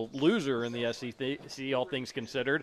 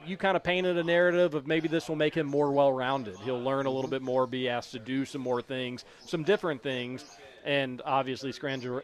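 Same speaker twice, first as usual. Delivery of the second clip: ordinary delivery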